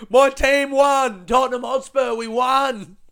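loud, high, drawn-out victory cry; re-enacted euphoric football shout; overjoyed shouting. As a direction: bellow the win like an ecstatic fan. Retold euphoric announcement to cafe lady.

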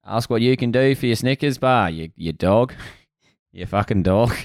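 mock Australian speechifying; Australian accent. Riffing on a Snickers bar speech, Australian accent. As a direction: ham up an Australian voice, ending in insults.

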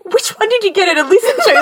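high-pitched incredulous question; retold alarm, pitched well up; alarmed disbelief. Daughter asking mum which episode auntie heard, high and alarmed.

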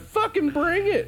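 high, slow mock tough-guy yell; retold challenge. Stranger challenging the drunk teen to fight, high mock yell. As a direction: dare them to come at you.

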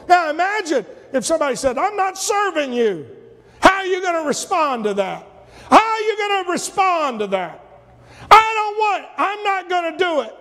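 high-pitched, challenging pulpit delivery; forceful preaching. Acted. Preacher challenging listeners with a blunt refusal, forceful preaching.

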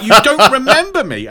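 high mock-hurt disbelief; Jessica. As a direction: protest in a high imitation voice. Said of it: "And she's like".

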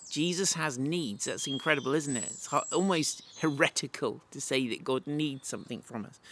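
ordinary delivery

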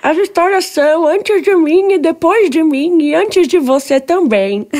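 put-on high, loud old lady voice; frail breathless old person. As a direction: croak it out like an ancient nanny. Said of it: "Tem que falar com voz de velhinha".

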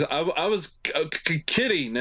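mock stammer on the first sounds; imitated stutter. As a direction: stumble over the k, imitating a stutterer. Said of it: "and he's a stutterer too".

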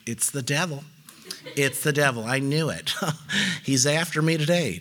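lightly put-on low voice of an over-spiritual churchgoer; hint of hammed-up suspicion; mock church voice. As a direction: mimic someone blaming the devil, pitched low. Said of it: "I don't know why I do the voice".

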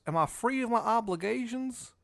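high put-on character voice; Shithead Steve. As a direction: plead it high in the Maryland character voice. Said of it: "So he goes".

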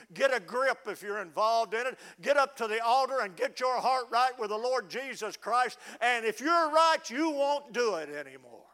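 loud altar call; fiery preacher pushing the altar call; preaching hard. Acted.

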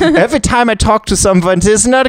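put-on high, complaining German accent; German mad scientist. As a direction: grumble high-pitched in comic German accent. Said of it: "decided to give him a German accent".

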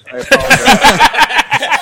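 slow, high, loud hammed-up sorry; mock apology. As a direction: drag out a fake-formal apology. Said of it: "Sorry, I wasn't paying attention".

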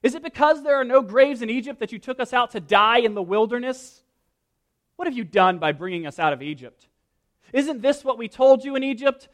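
loud, high mocking complaint; a hint of bitter sarcasm; sarcastic. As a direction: sneer it back at Moses, loud.

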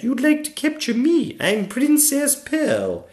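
put-on high-pitched young princess; Princess Pearl. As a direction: introduce yourself in a high girlish voice. Pearl offers herself.

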